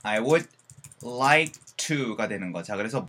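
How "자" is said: ordinary delivery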